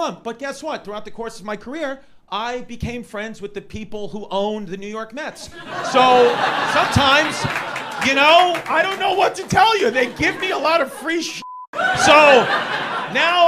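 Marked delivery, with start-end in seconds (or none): 5.9-13.5 loud excited admission. Real, not acted. Excited confession to crowd.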